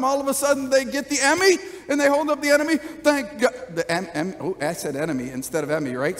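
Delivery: ordinary delivery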